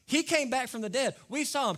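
high, loud, eager insistence; excited disciples. Acted. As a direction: convince him, thrilled. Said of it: "The other disciples are like".

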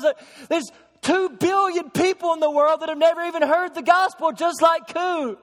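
high and loud, preaching at full force; impassioned preaching. Acted. Preacher to congregation about the unreached millions, impassioned and soaring. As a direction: lift the room with burning urgency.

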